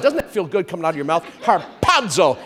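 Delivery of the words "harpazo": "Harpazo" with a bursting middle syllable; har-PA-zo, relishing the punch in "Harpazo"; explosive PA. Shown as a demonstration. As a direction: pop the P hard in "Harpazo". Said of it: "It's like, pa".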